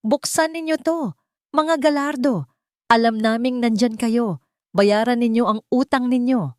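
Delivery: loud, high-pitched shouting; angry demand. A narrator's reading. Debt collectors outside the apartment, shouting angrily. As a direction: yell threats through a shut door.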